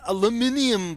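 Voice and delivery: "aluminium" as al-yoo-MIN-ee-um; British pronunciation. Retold or imitated. Reading a Brit's comment, "aluminium" the British way. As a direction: say "aluminium" with the extra "ee" before "um". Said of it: "trying to say is aluminium".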